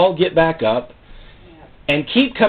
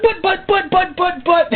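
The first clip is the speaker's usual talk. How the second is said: much higher, spluttering buts; flustered stammer; slower than usual. Retold or imitated. Preacher caught out by God's answer, much higher and flustered. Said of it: "I went".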